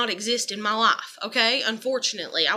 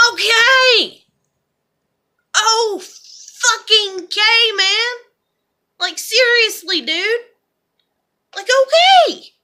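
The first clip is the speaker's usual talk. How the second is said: much higher and louder, bitter okays; exasperated; 9 semitones higher, 8 dB louder, much slower than usual. Real, not acted. Answering his scolding, high loud exasperated okays. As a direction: throw the okays back, furious and hurt. Angry at being told off.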